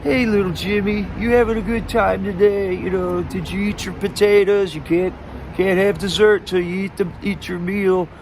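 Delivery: put-on high-pitched grown-up coaxing a small child; mock baby talk. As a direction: talk down to little Jimmy like a sugary parent. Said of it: "You know how you change your voice when you're talking to a little kid".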